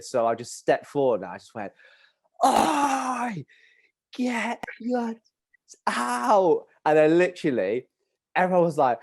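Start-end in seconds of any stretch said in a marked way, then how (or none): 2.4-6.7 high, drawn-out call across a field; shouted heckle. Retold or imitated. Schoolboy heckling a match from afar, shouted. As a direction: yell it out slowly to carry far. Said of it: "someone shout it".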